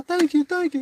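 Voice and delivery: grateful crowd. Retold or imitated. Grateful people under bridge.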